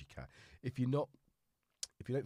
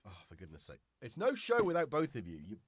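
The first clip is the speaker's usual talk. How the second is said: ordinary talk, nothing marked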